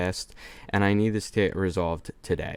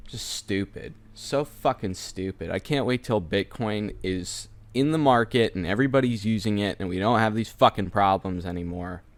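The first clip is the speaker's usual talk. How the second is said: ordinary talk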